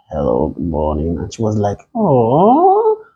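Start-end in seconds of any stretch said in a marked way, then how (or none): 0.1-1.2 low, soft seductive hello; sexy morning voice. Retold or imitated. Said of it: "that early morning voice, like, it was sexy". 1.9-3.0 swooning oh. Retold or imitated. Lady reacting to sexy voice.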